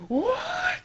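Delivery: very high squeal of disbelief; hammed-up high-pitched shock; freaking out. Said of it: "they're freaking out".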